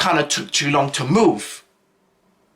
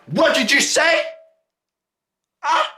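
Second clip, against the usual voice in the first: much higher, confrontational shout-like challenge; angry challenge; 13 semitones higher than usual. Retold or imitated. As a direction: square up to him, furious. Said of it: "he was like".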